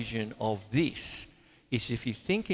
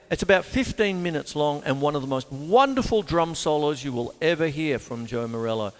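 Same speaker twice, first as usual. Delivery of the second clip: normal talking voice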